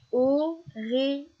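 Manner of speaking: "Aurélie" slowly in three spaced beats; syllable by syllable. Shown as a demonstration. Teacher showing a child the last syllable, "Aurélie" slowly split. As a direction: separate "Aurélie" into Au... ré... lie. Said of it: "répéter la dernière syllabe de Aurélie".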